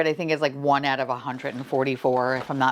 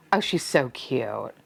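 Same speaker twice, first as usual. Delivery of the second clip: ordinary talk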